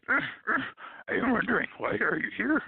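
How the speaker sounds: high Muppet voice with Yoda word order; Grover as Yoda. Retold or imitated. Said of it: "Grover is related to Yoda".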